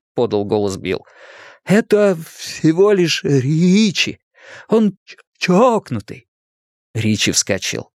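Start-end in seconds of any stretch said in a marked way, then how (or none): 1.6-6.3 put-on slow, higher stammering boy; halting, stretched words with a stutter. A narrator's reading. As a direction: labour through it, catching on consonants. Stuttering boy speaks in story.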